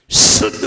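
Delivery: शुद्ध with a strong, long whistling s; शुद्ध, opening on a loud sustained sibilant; hissed initial sibilant. Shown as a demonstration. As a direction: lean hard on the hiss that opens शुद्ध. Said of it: "आवाज देखिए".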